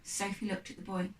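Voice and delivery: ordinary delivery, nothing marked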